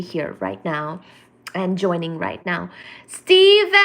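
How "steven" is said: high, loud squeal of a name; thrilled shout of greeting; excited call. Real, not acted. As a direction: call out his name with delight.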